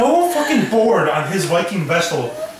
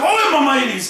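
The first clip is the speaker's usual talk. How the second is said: higher-pitched hammed-up pirate cry; pirate style; 6 semitones higher than usual. Victor calling out in battle, almost pirate style. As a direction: call out like a pirate. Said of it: "almost like pirate style".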